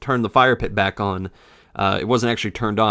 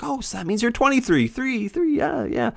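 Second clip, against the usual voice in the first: put-on high, childish kid voice; sing-song like a kid doing sums; imitating a kid; 13 semitones higher, 4 dB quieter than usual. Kid guessing the narrator's age, high childlike voice.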